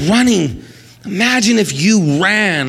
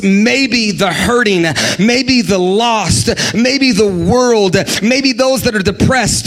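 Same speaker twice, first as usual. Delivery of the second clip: slow, weighty preaching list, each maybe stressed; slow building refrain; slower than usual. Acted. Preacher to congregation, slow weighty litany.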